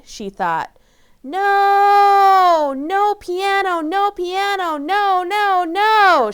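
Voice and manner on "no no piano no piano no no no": put-on high, loud child's scream of no; furious girl screaming at her mom; screamed tantrum. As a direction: scream it like a kid throwing a tantrum. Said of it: "she screamed".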